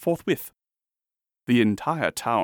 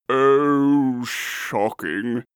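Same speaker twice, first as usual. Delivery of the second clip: groaning earl; 3 semitones higher, 5 dB louder, much slower than usual. A narrator's reading. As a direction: groan it out, heavy on the "Oh". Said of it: "groaned the Earl".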